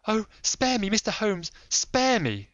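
high, loud desperate begging; frantic pleading. Acted. Kneeling lady begs Holmes, frantic high plea. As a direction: beg him with everything. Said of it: "pleaded in a frenzy of supplication".

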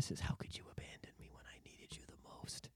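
whispered ghostly plea. Acted. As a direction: breathe it out like a ghost.

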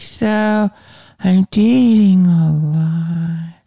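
high, slow, hesitant open-mic comic voice; nervous young comic. Retold or imitated. New comic at a young comedy show, slow, high and unsure. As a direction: drag it out like a shaky beginner comic. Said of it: "the comic's like".

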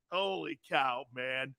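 usual speaking voice

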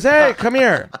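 high, loud motherly call; mom summoning. Retold or imitated. Mom calling her high son into her room. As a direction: call him in like a stern mom.

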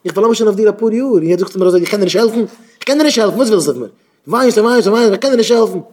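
high, loud, acted fed-up outburst; exasperated refusal. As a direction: turn the pleader away, at the end of patience. Acts man refusing a pleading dependant. (געשפילטער אויפגעבראכטער אפזאג)

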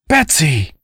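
high-pitched startled call; shocked cry. A narrator's reading. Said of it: "Cassandra cried".

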